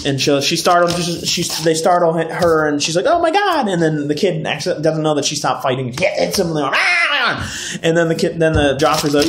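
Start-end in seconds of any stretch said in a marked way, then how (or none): 3.1-3.7 mock startled cry. Recapper voicing the startled girl, mock shock. 6.7-7.8 high, drawn-out imitated cry; imitated yelp of pain; mock pained yelp. Recapper acting the kid getting hit, mock yelp.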